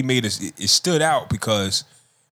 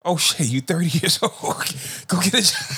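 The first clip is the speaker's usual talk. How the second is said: high, laughing mock dad voice; mock scolding dad; 7 semitones higher than usual. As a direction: brush off the lazy son with a laugh. Dad replying to spoiled son.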